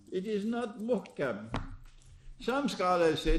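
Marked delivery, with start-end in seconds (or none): none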